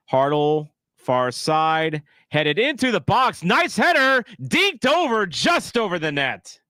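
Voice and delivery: rising, excited football commentator call; match commentary, building to the header; play-by-play commentary. Pretend.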